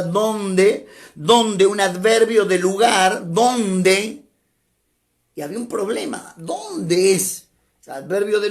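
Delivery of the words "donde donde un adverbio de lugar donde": donde repeated and hammered home; teacher drilling the word donde; stressing donde. Shown as a demonstration. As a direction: punch the word donde each time. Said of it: "quiero recalcar esta palabra".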